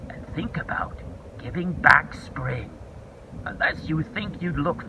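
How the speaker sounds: whispered warning. Acted. Caterpillar warning the dragon to give back spring, whispered. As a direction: hush it like a secret threat.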